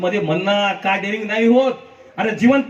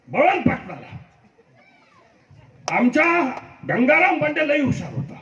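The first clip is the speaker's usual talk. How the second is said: a slow, higher imitation of a local leader; Kishan Rathod impression; 5 semitones higher than usual. Imitating Kishan Rathod while praising local elders at the event. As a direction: lightly mimic Kishan Rathod's slow speech. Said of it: "मी किशन राठोड साहेबाचा मी आवाज काढतो".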